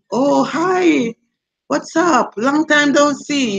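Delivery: put-on high-pitched, drawn-out Jamaican hello to old friends; Jamaican greeting. Greeting friends back home after a long absence, in high Jamaican lilt. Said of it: "I still speak Jamaican language".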